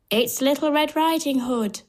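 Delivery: high disguised wolf voice; wolf faking soft voice. A narrator's reading. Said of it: "The wolf makes his voice soft".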